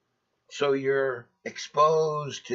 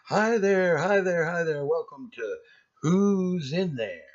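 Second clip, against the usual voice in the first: game show host; 6 semitones higher, 5 dB louder than usual. Retold or imitated. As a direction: ham it up like a TV game show host welcoming the crowd. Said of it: "it's kinda like a game show".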